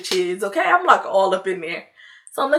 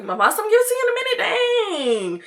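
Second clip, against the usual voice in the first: high, stretched whine of mock annoyance; whiny exasperation; 13 semitones higher than usual. Friend putting off his demands for the phone, whiny exasperation. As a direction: whine it high and drag out the last word.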